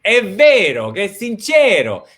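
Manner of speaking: È vero, che è sincero said with a lively Italian lilt; Italian phrase. Real, not acted. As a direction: slip into Italian with flair. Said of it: "I love your Italian accent that snuck in there".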